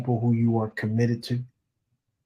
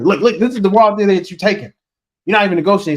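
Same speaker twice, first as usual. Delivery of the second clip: louder, higher, urgent warning; scolding call-out; 7 semitones higher, 10 dB louder, faster than usual. Real, not acted. Coach to listeners taking a bad deal, loud scolding. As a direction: wake them up before they take the deal.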